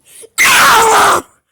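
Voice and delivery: loud, high strained screeching noise; funny scream noise. Pretend. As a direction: shriek out a silly cry at full volume. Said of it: "make another funny noise".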